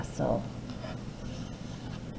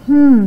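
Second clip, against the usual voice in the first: a loud, high thinking hmm; curious hum of doubt; 6 semitones higher, 9 dB louder than usual. A narrator's reading. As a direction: muse out loud, puzzled. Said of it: "thought Jack".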